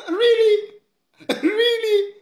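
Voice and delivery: high, laughing "Really?"; incredulous laughing retort; laughing disbelief. Real, not acted. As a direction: laugh it off as absurd. Mocking the speaker's demand.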